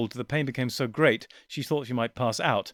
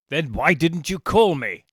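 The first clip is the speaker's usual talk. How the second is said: angry; 4 semitones higher, 7 dB louder than usual. Acted. Commander rebukes his men.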